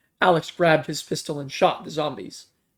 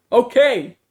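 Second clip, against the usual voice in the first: loud, higher okay called back; shouting; 7 semitones higher, 9 dB louder than usual. A narrator's reading. The man answering the strangers, loud shout. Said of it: "shouted the man".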